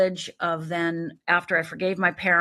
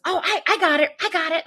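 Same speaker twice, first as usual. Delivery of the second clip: higher, eager dad voice; he's just had a bright idea; 9 semitones higher than usual. Retold or imitated. Imitating her dad in heaven, eager and higher. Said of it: "my dad at the time goes".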